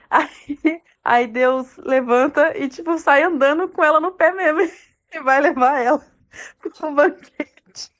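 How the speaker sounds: high, loud words breaking up with laughter; laughing while talking. Real, not acted. Teller amused at girl riding God's foot, laughing. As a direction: tell it through real giggles, barely getting it out.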